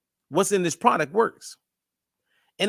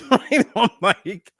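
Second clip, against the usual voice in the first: laughing; 4 semitones higher, 4 dB louder, slower than usual. Real, not acted. Laughs at own ignorance about Midol.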